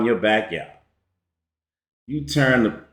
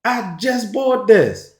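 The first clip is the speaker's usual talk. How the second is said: higher, slowing on I just bought this; outraged disbelief pitched up high; incredulous; 9 semitones higher than usual. Retold or imitated. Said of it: "And you thinking".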